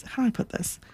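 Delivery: a secretive little whisper to the listener; whispered aside. Real, not acted. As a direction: drop to a hushed whisper, musing to herself. Repeats phrase, hushed aside.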